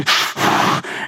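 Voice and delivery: short smoker's puff; imitated cigarette drag. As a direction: mimic puffing on a cigarette. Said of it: "She took the deepest drags of her ciggy".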